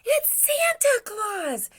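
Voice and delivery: very high, slow, loud squeal; excited Baby Bear. A narrator's reading. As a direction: cry out the discovery as a little bear. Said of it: "said Baby Bear".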